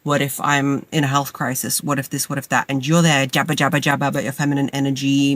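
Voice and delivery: usual speaking voice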